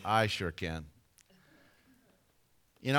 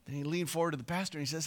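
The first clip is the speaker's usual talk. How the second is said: ordinary delivery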